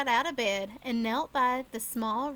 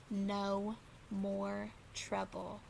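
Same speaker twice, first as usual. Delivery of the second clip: slow, low, hushed warning; very soft and slow, lower than before; stern low repeat; 4 semitones lower, 9 dB quieter, much slower than usual. Acted. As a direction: press the point quietly. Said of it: "she repeated".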